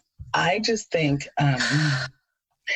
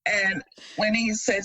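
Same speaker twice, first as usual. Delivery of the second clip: usual speaking voice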